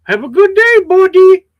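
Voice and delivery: high, loud fake-friendly shop clerk; mock the clerk's hollow farewell; loud, high sing-song clerk goodbye; mock insincere clerk. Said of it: "they're not sincere when they say it".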